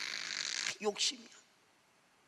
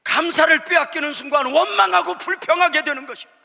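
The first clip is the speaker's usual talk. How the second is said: 빼앗기는 and 원망하고 driven loud and much higher; loud preaching; 12 semitones higher, 8 dB louder than usual. Real, not acted. Pastor proclaims the warning loudly to the congregation. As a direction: proclaim it at full force.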